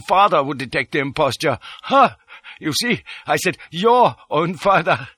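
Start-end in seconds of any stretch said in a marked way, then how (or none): 1.8-5.1 high bark of "Ha", then "your" punched; gloating stress on YOUR. A narrator's reading. Detchard points out his own words, gloating with stress on "your". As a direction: lean hard on "your", pitched high.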